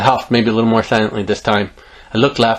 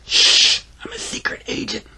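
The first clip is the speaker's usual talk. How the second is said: secretive whisper; 11 semitones higher than usual. Acted. Little girl confides she is an agent, secretive whisper. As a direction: hush and whisper like a spy.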